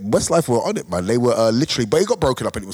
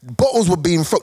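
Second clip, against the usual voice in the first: ordinary talk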